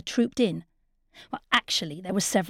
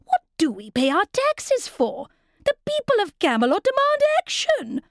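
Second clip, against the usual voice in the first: high, haughty editorial crier; pompous; 10 semitones higher than usual. Acted. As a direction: puff up and demand action. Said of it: "demanded the editorial crier pompously".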